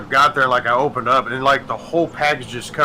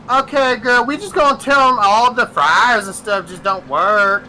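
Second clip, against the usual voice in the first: sassy coworker; 7 semitones higher, 4 dB louder than usual. Retold or imitated. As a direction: do the lazy McDonald's worker calling her friend.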